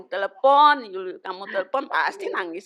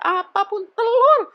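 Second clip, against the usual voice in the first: mock enthusing; 13 semitones higher, 6 dB louder than usual. Friend voiced, retold.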